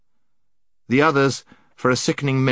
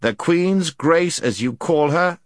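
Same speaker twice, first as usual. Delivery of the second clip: slow, raised pitch, firm prince; a touch of severity; stern; 8 semitones higher, slower than usual. A narrator's reading. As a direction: spell it out sternly and slowly. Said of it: "said the prince sternly".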